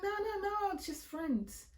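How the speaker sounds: mock girly friend. Friend's quote, squeaky high.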